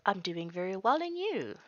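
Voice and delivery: lightly voiced granny pleased by visitors; sweet old lady. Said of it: "She's like".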